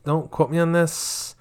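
high, loud put-on friend on the phone. Pretend friend answering a quiz phone call, high loud voice. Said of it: "phone a friend".

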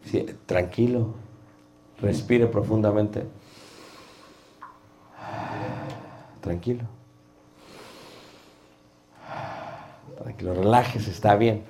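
soft, calming tranquilo; reassuring. Shown as a demonstration. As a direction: soothe a nervous speaker gently. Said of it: "calming a nervous preacher". (tono tranquilizador y suave)

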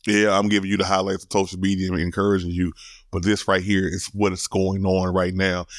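ordinary talk

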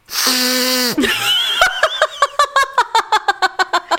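big laugh at the memory; burst of laughter. Real, not acted. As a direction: crack up laughing.

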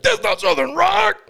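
high, slow squeal of outrage; very high, drawn-out mock objection; squeaky mock protest. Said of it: "you'd be like".

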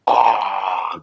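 normal talking voice

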